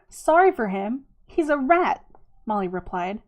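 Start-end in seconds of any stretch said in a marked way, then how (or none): 0.1-2.0 raised, louder incredulous retort; indignant retort. A narrator's reading. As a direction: throw the pity back in disbelief. Molly scoffs at Rocky's pity.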